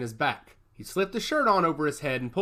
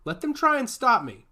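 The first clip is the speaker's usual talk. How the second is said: defiant; 9 semitones higher, 6 dB louder than usual. A narrator's reading. Victor defies orders before mission.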